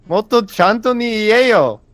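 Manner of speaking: high, loud exasperated order; loud scolding demand. Real, not acted. Demands that the mumbler speak properly.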